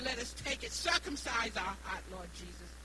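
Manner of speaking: ordinary delivery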